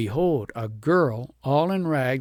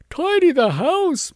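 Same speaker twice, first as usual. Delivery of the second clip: higher, louder, slow wail; moaning; 8 dB louder, slower than usual.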